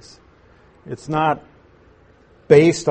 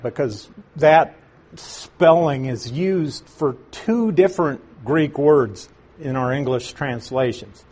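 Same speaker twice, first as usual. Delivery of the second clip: ordinary talk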